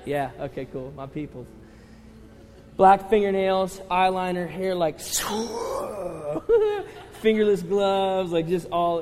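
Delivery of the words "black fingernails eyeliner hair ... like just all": loud, high, laughing through the list; laughing description. Real, not acted. Youth pastor laughing as he describes the kid, loud. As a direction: crack up while describing him.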